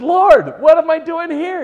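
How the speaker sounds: very high, very loud wail to God; mock-anguished shout; desperate cry. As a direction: howl it like a man stranded. Desperate prayer in his room.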